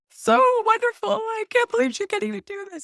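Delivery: high, loud, emotional squeal of delight; teary gushing. Retold or imitated. Teary-eyed fan gushing over a Wicked video, high squeal. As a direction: gush like you're about to cry with joy.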